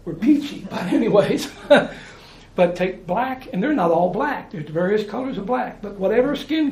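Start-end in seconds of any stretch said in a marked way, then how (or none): none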